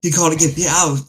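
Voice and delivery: injured brother pleading with Drake to get him out; pleading lost brother. Acted. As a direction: beg to be rescued, voice climbing.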